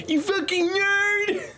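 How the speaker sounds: high, strained screeching insult; soundboard clip. Retold or imitated. Streamer echoing a soundboard, screeching insult. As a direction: shriek it like a cartoon clip. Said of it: "I love half these fucking soundboards".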